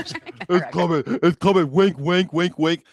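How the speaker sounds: high, teasing mock hint; mock conspiratorial. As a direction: needle him with a knowing nudge. Mocking guest's hint.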